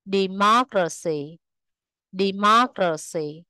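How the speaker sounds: deMOCracy, with MOC stressed; third-from-last syllable stress. Shown as a demonstration. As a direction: stress the second syllable of democracy, said carefully twice. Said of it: "rơi vào âm tiết thứ ba từ dưới lên".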